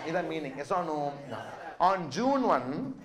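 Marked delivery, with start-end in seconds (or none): 1.8-2.9 slow and deliberate, stressing "June one"; slow emphasis. Real, not acted. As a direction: spell out "On June one" slowly. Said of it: "again I say on June one".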